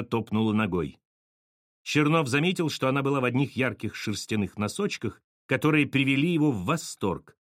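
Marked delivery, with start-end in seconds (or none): none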